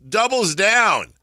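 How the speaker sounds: big slow announcer home run call. Retold or imitated. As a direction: belt it out like play-by-play. Mimicking the team's announcer.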